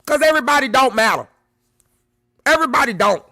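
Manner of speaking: loud, fast, hammering everybody; emphatic rant. Real, not acted. Telling the audience everybody doesn't matter, loud and fast. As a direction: slam the point home. Ranting punchline.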